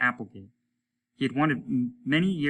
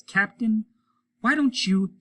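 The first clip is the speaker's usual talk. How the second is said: high, louder needling voice; provoking; 11 semitones higher, 8 dB louder, slower than usual. Acted. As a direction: start to mock the captain. Applegate provokes captain.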